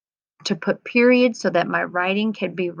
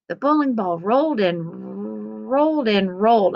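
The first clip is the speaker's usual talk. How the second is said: normal talking voice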